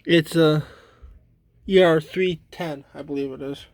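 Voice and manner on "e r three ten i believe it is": usual speaking voice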